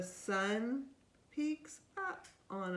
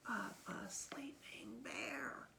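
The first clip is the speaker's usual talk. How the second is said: whispering; 10 dB quieter than usual. Real, not acted. Hushed explanation to child.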